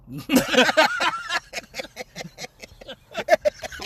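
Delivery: a real high giggle at his own slip. Real, not acted. Laughing after nearly saying a coworker's name. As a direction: laugh it off, caught out.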